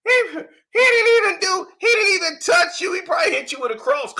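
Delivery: laughing shout. Real, not acted. Mocking shout while laughing.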